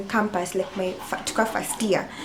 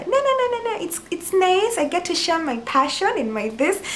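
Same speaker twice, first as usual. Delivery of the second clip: over-excited nervous squeak, demonstrated; high-pitched cartoon voice; 10 semitones higher than usual. Shown as a demonstration. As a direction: imitate your tense voice, pitched way up like a cartoon. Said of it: "I usually speak in that cartoon".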